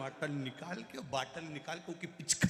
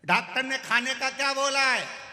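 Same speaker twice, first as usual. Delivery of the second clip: loud question; 9 semitones higher, 10 dB louder than usual. Retold or imitated. बहरा आदमी ज़ोर से पूछता है.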